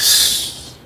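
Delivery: hushing. Real, not acted. Hushing him with headphones on.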